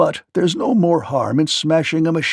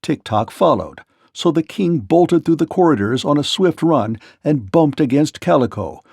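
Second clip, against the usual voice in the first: usual speaking voice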